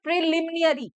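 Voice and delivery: "प्रिलिमिनरी" with an extra "ni-a" before the ending; common mispronunciation. Retold or imitated. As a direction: mimic the mistake: "प्रिलिमिनरी" as "pri-li-mi-ni-a-ree". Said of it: "गलत pronounce करते हैं".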